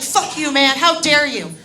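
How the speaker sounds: acted indignant telling-off; indignant outburst. Friend telling off a man who grabbed her, indignant. Friend fights off drunk man's grab.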